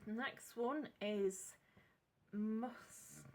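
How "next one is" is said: ordinary talk, nothing marked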